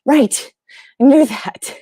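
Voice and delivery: lightly played dawning recognition, ending in a laugh; acted realization. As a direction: act out suddenly remembering what you knew. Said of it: "And we're like".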